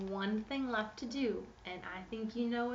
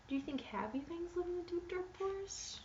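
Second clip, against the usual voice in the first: high and quick, mock-innocent; high teasing question; 8 semitones higher, 5 dB quieter than usual. Reading to kids, high teasing question. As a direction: ask it brightly as if happy things could live there.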